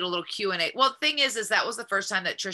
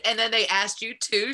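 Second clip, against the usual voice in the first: normal talking voice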